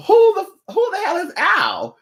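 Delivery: who the hell is Al, yelled up high in mock outrage; hammed-up indignant squeal; exasperated outburst. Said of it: "And I'd be like".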